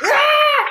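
high, loud mock scream; play scream. Podcaster under pretend gunfire, high scream. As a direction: shriek as if shot at.

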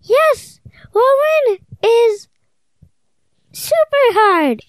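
put-on high girl voice. Child voicing Tina.

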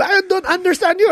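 very high mom voice with a mock Filipino accent. Mom telling the caller, mock Filipino accent. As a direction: imitate mom's Filipino accent, high with a rising end. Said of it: "And I go".

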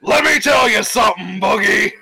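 loud, high wrestler trash talk; wrestling promo shout. Retold or imitated. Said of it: "Cut, cut a wrestling promo".

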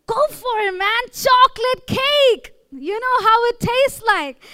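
a hyped-up tempter urging you to indulge; tempting inner voice. Acted. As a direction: voice the greedy craving part of the brain, drawn out. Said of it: "your intimate gratification is more like".

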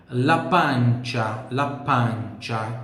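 "pancia" with a wide open A, very slow. Shown as a demonstration. Teacher slowly models "pancia" with open A. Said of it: "O A sempre bem aberto".

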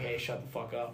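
soft, laughing mock rebuke; muttered joke. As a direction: toss it off quietly, laughing. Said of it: "just tell them, like".

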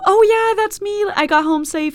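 high, loud cheery call-out; retold calling out. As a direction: holler it over, overly breezy. Calling out to neighbor and police.

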